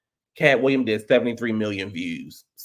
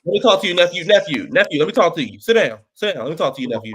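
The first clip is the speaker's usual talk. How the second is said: loud insistent uncle calling his nephew over; pushy uncle; 4 semitones higher, 5 dB louder, faster than usual. Acted. Uncle at a cookout cornering his nephew, louder. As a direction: grab him with your voice and don't let go.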